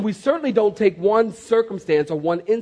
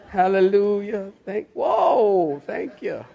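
drawn-out parody of a worshipper; lightly hammed-up church murmuring; mock worshipper praise; slower than usual. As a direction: imitate a congregant lost in praise. Mimicking worshippers in tongues.

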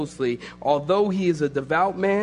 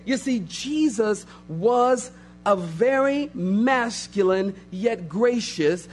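normal talking voice

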